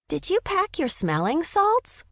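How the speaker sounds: high-pitched boyish mockery; teasing boy. A narrator's reading. Son poking into mother's bike basket, teasing. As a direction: feign helpfulness in a high kid voice.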